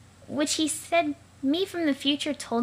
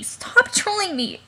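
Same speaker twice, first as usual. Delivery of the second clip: desperate plea; 8 semitones higher, slower than usual. Acted. Pleading with troll to stop.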